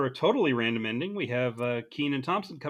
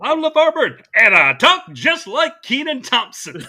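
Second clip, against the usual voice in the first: mocking Kenan Thompson; 11 semitones higher, 13 dB louder than usual. Host mocking a phoned-in LeVar Burton impression, hammed-up shout. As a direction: belt it out high, stretched and laughing. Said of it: "He was like".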